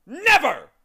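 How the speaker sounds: high, loud booming denial; emphatic magician. Acted. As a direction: bellow the refusal like a proud wizard. Magician denies, character voice.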